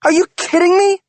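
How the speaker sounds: very high, loud outraged question; outraged shout; furious disbelief. Acted. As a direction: shout it, incredulous. Wife confronts cheating husband.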